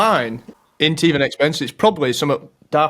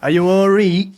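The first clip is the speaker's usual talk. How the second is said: hammed-up Yorkshire "Are you all Reet?", higher and louder; mock Yorkshire accent; 5 semitones higher, 4 dB louder than usual. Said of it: "taking mick out your Yorkshire accent".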